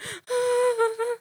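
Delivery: sharp high gasping breath in, hammed-up; a playful mock panic gasp; mock hyperventilating gasp. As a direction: gasp for air like you're panicking alone in your room. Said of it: "hyperventilating and shit".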